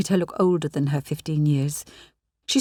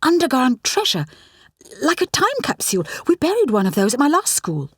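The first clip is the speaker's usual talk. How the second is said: bright eager chatter, higher; excited about buried treasure; grinning teen; 7 semitones higher than usual. A narrator's reading. Rani grins about buried treasure, higher and eager. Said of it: "grinned Rani".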